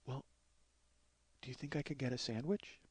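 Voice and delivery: hushed, hesitant request; meek little plea; timid imitation. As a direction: ask very softly, pausing after the first word. Imitating frail woman asking hesitantly.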